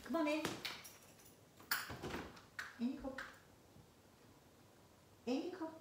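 high, soft pet-calling voice; coaxing a cat. Real, not acted. Reader lets the cat in, coaxing softly.

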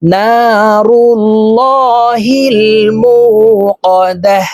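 slow, high, melodic tilawat; Quran recitation. Shown as a demonstration. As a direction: chant it slowly with tajweed. (ধীর সুরেলা কুরআন তিলাওয়াত)

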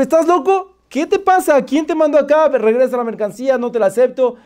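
high, agitated tirade; angry rejection. Retold or imitated. Customer refusing goods from the driver, outraged.

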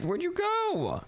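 high, slow, drawn-out puzzled call; baffled call. Real, not acted. Brother vanished through a portal, slow high baffled call. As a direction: stretch it out in confusion. Lost sight of her brother.

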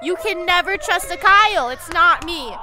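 loud pleading protest. Real, not acted. Player yelling at the ghost Claire to drown out her voice, loud. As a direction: shout your innocence at the ghost. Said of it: "scream over it".

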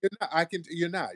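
ordinary talk

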